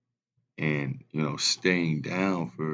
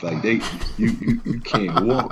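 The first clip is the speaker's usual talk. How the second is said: laughing; 2 semitones higher, 6 dB louder than usual. Real, not acted. Laughing through a funny story.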